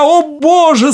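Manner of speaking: high, loud tearful outcry; tearful cry. Acted. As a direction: cry out in tears. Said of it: "закричал, заливаясь слезами".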